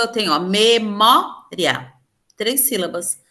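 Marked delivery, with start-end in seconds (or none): 0.4-1.9 MEMÓRIA slowly split me-mó-ria; syllable by syllable. Shown as a demonstration. Teacher separating syllables for the class, slow syllable by syllable. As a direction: break memória into three separate beats, me... mó... ria. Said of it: "Quando eu separo a sílaba".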